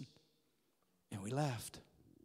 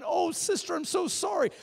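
tearful apology; 10 semitones higher than usual. Retold or imitated. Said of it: "starts crying".

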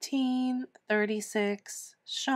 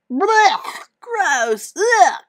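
loud disgusted gagging after a kiss; dry heaving; 10 semitones higher, 10 dB louder, much slower than usual. Acted. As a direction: gag and retch in disgust.

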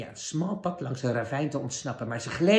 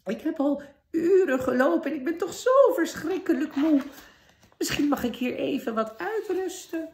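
put-on trembling old woman; 5 dB louder than usual. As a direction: quaver like a frail old woman hiding a wicked queen. Disguised queen begs to rest.

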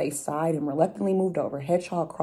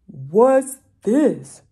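louder bear question; a hulking bear sniffs; big bear voice; 5 semitones higher, 10 dB louder than usual. Acted. Bear finds the mitten, loud.

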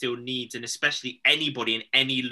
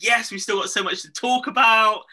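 higher, louder burst of mock enthusiasm; mock eager quote; 9 semitones higher, 5 dB louder than usual. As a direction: play the keen first-lockdown crowd, up and loud. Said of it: "everybody was like".

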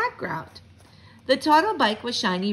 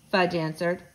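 plain reading voice

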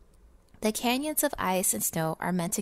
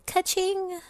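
high, bright ka-ching sound; cash register imitation; 10 semitones higher than usual. Miser celebrating cheap labor with a high ka-ching. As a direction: ring it out like a till.

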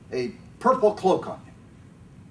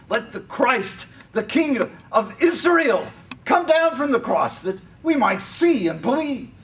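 high, loud scornful challenge; loud mockery; 9 semitones higher, 4 dB louder than usual. A narrator's reading. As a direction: call it out to taunt him. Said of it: "mocked him to one another".

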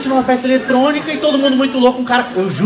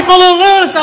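confused loud reply; 9 semitones higher, 7 dB louder, slower than usual. Retold or imitated.

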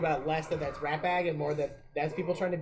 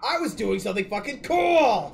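higher, louder huffy complaint; mock indignant whine; 7 semitones higher, 6 dB louder than usual. Friend voicing an orc whining about interruption. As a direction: protest like a sulking orc.

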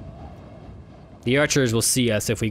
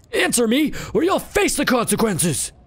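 put-on threatening old beggar; 9 semitones higher than usual.

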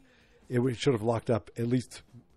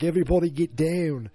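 raised, louder Australian mock shout; Australian accent; 6 semitones higher, 5 dB louder than usual. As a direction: imitate an Australian calling out a warning.

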